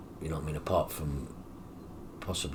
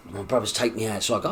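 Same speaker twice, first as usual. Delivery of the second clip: ordinary delivery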